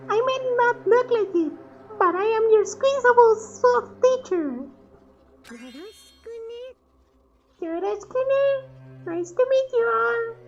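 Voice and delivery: very high squeaky plush voice; cute mascot teacher greeting the class; talking plush toy. Acted. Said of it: "stuffed animal's voice".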